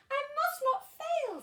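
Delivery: high, loud, resolute little voice; determined snail. A narrator's reading. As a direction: steel yourself to save a friend. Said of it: "said the tiny snail".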